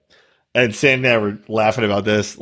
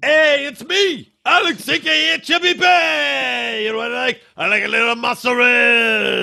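Alex as Brooklyn Italian; 11 semitones higher than usual. Acted. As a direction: holler like a cartoon Brooklyn Italian. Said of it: "He is not an Italian man from Brooklyn".

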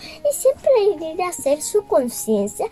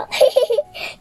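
laughing; 10 semitones higher than usual. Acted.

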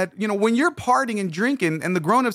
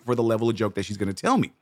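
ordinary talk

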